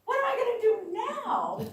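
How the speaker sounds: high, loud wail of mock despair; despairing cry. Grieving granddaughter wails to no one, loud and high. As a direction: cry it out like you've lost her. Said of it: "It's like".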